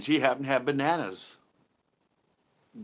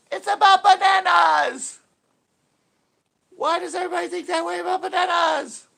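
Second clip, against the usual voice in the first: Seinfeld impression; 10 dB louder than usual. Streamer showing off his high Seinfeld impression to chat, high and loud. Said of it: "I did go into my Seinfeld impersonation".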